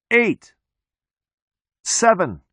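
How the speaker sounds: high-pitched count, each number separate; countdown call. A narrator's reading. Cop calls out a countdown.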